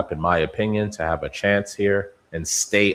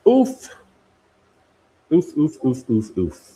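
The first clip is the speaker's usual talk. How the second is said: pained oofs; 8 semitones higher, 5 dB louder than usual. Real, not acted. Reacting to a possible breakdown.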